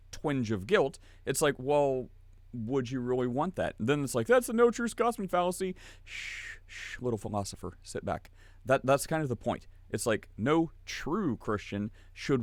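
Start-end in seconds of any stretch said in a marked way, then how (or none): 4.3-5.8 high-pitched know-it-all objection; mock objector. Mimics an objector quoting a fallacy. 6.0-8.3 soft, low shushing; mock hushing. Hushes the imagined objector.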